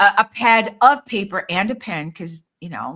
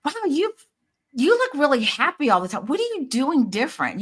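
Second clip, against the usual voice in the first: impressed friend; 6 semitones higher than usual. Retold or imitated. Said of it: "And people go".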